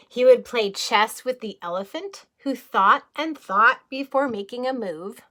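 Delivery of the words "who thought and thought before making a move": plain reading voice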